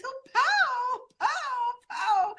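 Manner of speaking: high, giggly pow; laughing pow. Real, not acted. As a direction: squeal it through laughter. Trying to join the pow.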